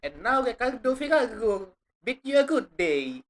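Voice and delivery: imitated theatrical Italian farewell; mock Italian accent. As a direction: echo the Italian gentleman grandly. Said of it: "I love this guy".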